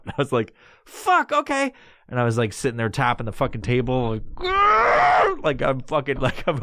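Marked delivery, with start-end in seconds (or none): 0.9-1.7 high-pitched panicked assent; flustered giving in. Retold or imitated. Stoner told to chill, flustered. 4.4-5.4 loud, very high "grrr"; pent-up energy squealed out; manic growling squeal. Retold or imitated. Stoner tapping the table, manic squeal.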